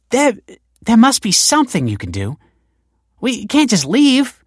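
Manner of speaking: desperate words tripping over each other; stammering in panic; panicked stammer. A narrator's reading. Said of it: "stammered the woman".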